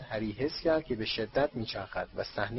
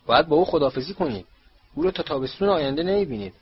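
louder, fast, irritated rebuke; stern, louder reprimand at a faster pace; annoyed scolding; 4 semitones higher, 7 dB louder, faster than usual. A narrator's reading. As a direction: scold them sharply and quickly. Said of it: "با اوقات تلخی".

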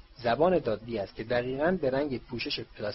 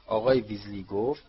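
ordinary delivery

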